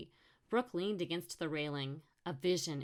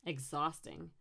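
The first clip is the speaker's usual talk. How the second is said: usual speaking voice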